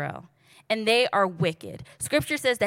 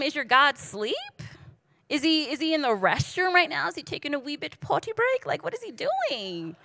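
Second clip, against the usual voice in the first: higher-pitched mock-innocent questioning; mocking taunt; 6 semitones higher than usual. Elijah to the prophets of Baal, mockingly teasing. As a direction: needle them with pitched-up, feigned curiosity.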